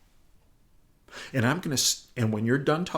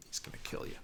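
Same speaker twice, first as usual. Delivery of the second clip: aide's unvoiced whisper, much softer; whispered warning; 14 dB quieter than usual. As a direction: hiss the warning sideways.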